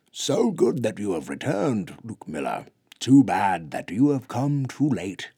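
grave giant's voice, lifted and loud; elder Bigfoot. Acted. Bigfoot elder telling Luke he came too late, grave. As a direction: welcome him, then let the blow land.

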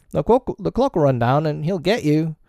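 high-pitched simple pirate; high Smee voice. Acted. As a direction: pitch it up as the dim bosun.

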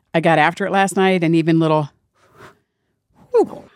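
usual speaking voice

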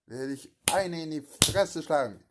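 loud, furious threat to punch; angry threat. Acted. Angry grandson-figure threatening a punch, loud. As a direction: shout it like you are about to hit him.

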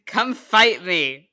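slow, loud playful taunt; mock challenge. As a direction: dare him, drawn out. Mock challenge to Steve Huff.

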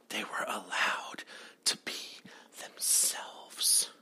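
whispered punchline. Real, not acted. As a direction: drop to a dramatic whisper. Dramatic point about wrestlers' freedom.

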